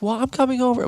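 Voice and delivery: very high insistent mom voice. Retold or imitated. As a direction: squeak it like an eager mother. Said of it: "So she's like".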